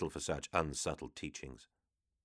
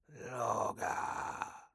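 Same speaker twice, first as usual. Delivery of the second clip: demon whisper; 5 semitones higher, 7 dB quieter than usual. Acted. Demon answers from afar.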